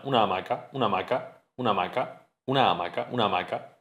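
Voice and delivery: "una hamaca" with the two a's fused into one; vowels merged. Shown as a demonstration. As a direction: say "una hamaca" softly as "unamaca". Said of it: "misma vocal seguida, se fusiona".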